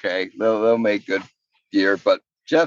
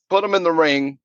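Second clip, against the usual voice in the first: ordinary talk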